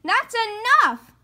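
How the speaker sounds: high, loud shout to stop; loud plea. Acted. Sister shouting at Brother to stop the wailing. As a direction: yell with fingers in ears. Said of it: "said Sister".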